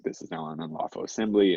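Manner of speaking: imitated loudspeaker order, pitched high; blaring police announcement. Witness imitating police truck dispersing protesters, blaring announcement. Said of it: "like, blaring".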